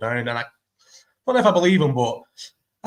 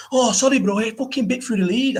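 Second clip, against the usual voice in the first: higher, whiny imitation of a lying dog owner; mock excuse; 8 semitones higher than usual. Quoting the dog owner's lame excuse to the speaker, mock whiny high voice. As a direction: mimic his feeble fake apology, pitched up. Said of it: "he started going".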